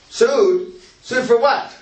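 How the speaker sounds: high, loud incredulous repeat. Retold or imitated. Retold general's baffled reply.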